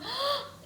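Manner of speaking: sharp, high intake of breath; theatrical gasp. Acted. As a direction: gasp in mock alarm. Reacting to picture book danger.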